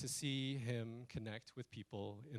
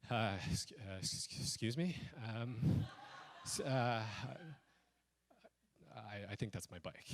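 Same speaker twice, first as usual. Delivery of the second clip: halting, stammering and softer; timid hesitation; 4 semitones lower, 5 dB quieter than usual. Retold or imitated. Speaking to the man taking his bike, timid and halting. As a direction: trail into long unsure pauses, false starts.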